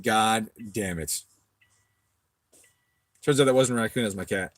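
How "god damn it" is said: slow, drawn-out exasperated curse; retold exasperation. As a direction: drag the curse out in weary self-reproach. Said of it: "And I was like".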